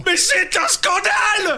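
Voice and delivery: high, drawn-out cry of scandal; outraged yelling. Acted. Actor protesting at the gun incident, outraged yell. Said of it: "il fait que de gueuler".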